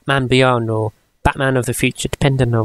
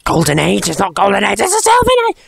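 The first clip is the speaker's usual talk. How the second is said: a hint of an outraged comic nerd, squeaking high at the end; mock pedantic fan; 6 semitones higher, faster than usual. Mocking comic fans who correct his ages, rising to a squeak. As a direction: lightly play the correcting fan who jumps up high on the last bit. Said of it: "you who read this and like".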